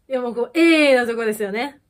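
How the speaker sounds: normal talking voice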